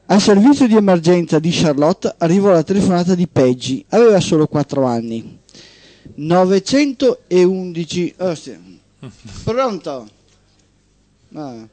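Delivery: steady radio storytelling voice; host reading a short story on air; story narration. Acted. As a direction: read it like a tale to the listeners. Host reads a story aloud.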